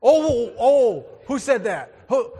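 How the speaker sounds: loud, high, playful outcry; mock shock. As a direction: pounce on the heckler in pretend outrage.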